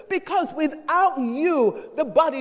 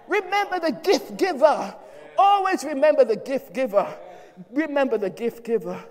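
preaching refrain. Acted. Pastor repeating a refrain to the church, insistent. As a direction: drive home the refrain.

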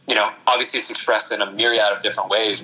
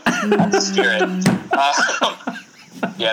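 laughing; 8 semitones higher, much slower than usual. Real, not acted. Laughing at friend's gloomy joke.

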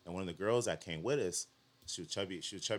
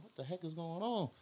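retold shock; 11 semitones higher than usual. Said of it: "I'm almost shocked".

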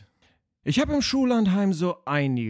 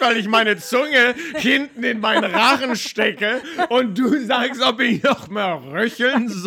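loud, laughing, higher, choked protest; laughing while choking; 9 semitones higher, 11 dB louder than usual. Real, not acted. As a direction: gasp it out through laughter. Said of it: "ich kriege hier keine Luft".

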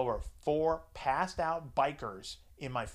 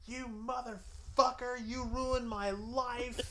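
retold scream; 11 semitones higher, much slower than usual. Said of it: "Just screaming at the fucking top of her lungs".

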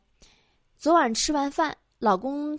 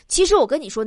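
usual speaking voice

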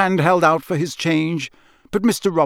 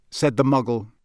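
ordinary delivery, nothing marked

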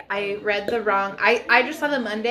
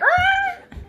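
creature squeak. Acted. Porg noise attempt.

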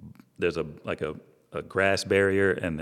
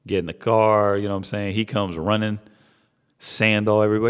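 ordinary talk

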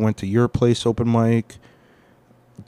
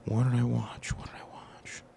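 unvoiced whisper, searching his memory; whispered self-question, trailing off mic; whispering to himself; 11 dB quieter than usual. Real, not acted. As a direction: murmur the question under your breath. Muttering to himself, trying to remember.

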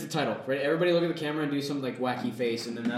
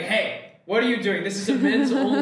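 raised, higher-pitched mock protest; mock objection; 8 semitones higher, 4 dB louder than usual. Guys telling off intruders at their men's-only hangout, mock outraged. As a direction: play indignant guys, voice climbing.

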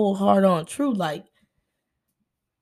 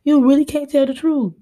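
higher, louder exasperated "Dang"; disbelieving quote; 7 semitones higher, 3 dB louder, slower than usual. Retold or imitated. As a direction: call them out, loud and fed up. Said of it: "'Cause it's like".

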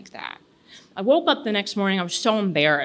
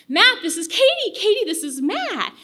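high, bright matchmaking voice; eager introduction; 11 semitones higher than usual. Retold or imitated. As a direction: present them like a prize. Girlfriend introduces them eagerly.

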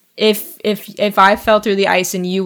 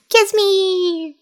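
much higher, hammed-up plea; Laurie impression; 11 semitones higher than usual. Imitating Laurie begging Jo for a kiss, high and hammed. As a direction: overdo the lovesick ask. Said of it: "That's my impression of Laurie".

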